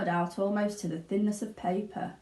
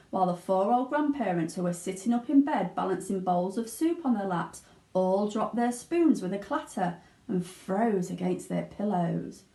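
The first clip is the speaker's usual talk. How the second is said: ordinary delivery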